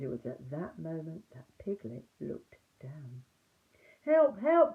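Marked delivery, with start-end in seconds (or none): 4.0-4.7 high, loud panicked cry; frightened cry for help. A narrator's reading. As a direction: shriek for help as if fleeing. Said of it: "cried Piglet".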